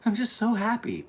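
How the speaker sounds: put-on high little-child voice; imitated tearful daughter. Little daughter to parent after the fireworks, imitated tearful high voice. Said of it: "she's crying".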